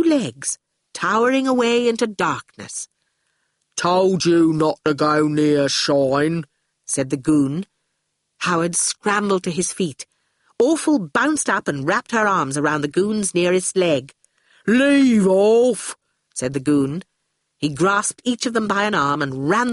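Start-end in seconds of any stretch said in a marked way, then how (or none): 3.8-6.4 slow, heavy goon voice. Acted. Goon stands over Howard, slow reproach. 14.7-16.0 loud, heavy goon protest; gruff goon. Acted. Awful clings to the goon's leg, gruff protest.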